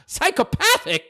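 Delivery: incredulous. Real, not acted. Incredulous at troll's insult.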